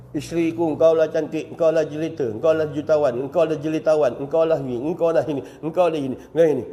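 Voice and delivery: woo her gently; tender praise. Acted. Husband reads praise to wife.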